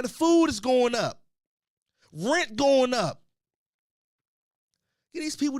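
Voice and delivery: loud, high complaint about prices; loud rant. Real, not acted. Ranting about rising costs.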